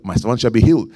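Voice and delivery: ordinary delivery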